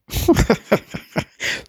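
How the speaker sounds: high helpless laugh; can't stop laughing; helpless laughter. Real, not acted. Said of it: "Sorry, it's not that funny".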